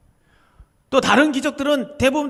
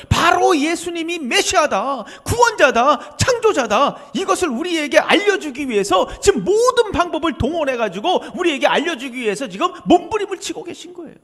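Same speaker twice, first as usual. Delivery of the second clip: preaching at full force; 7 semitones higher, 6 dB louder than usual. Acted. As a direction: hammer each name of Christ home, louder each time. Preaching climax.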